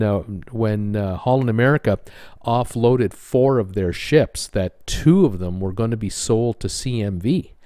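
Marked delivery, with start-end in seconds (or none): none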